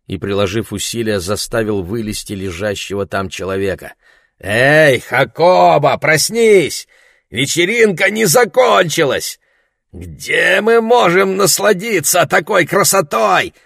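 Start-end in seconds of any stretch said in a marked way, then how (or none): 4.4-9.4 shouting. Acted. Rousing drunk friend, shouting. 9.9-13.5 put-on high, loud, slow drunken call; shouting. A narrator's reading. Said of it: "крикнул англичанин".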